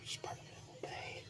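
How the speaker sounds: a whispered vow of revenge; vengeful whisper. Retold or imitated. Harry whispers his revenge vow at the funeral. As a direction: whisper the threat under your breath.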